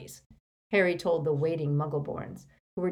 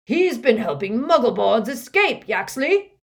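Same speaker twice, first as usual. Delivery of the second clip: higher, louder accusing shout; 6 semitones higher, 9 dB louder than usual. A narrator's reading. Harry as Runcorn accusing a wizard, shouting. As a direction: shout it to Yaxley. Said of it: "Harry shouted".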